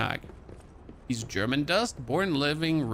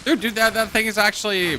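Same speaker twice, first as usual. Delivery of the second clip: higher, louder rattled babble; shaken excitement; 7 semitones higher, 6 dB louder than usual. Real, not acted. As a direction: still rattled from the scare. Shaken after fish scare.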